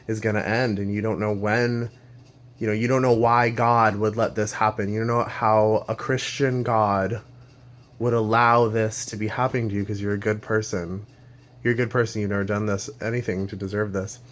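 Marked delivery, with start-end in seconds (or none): none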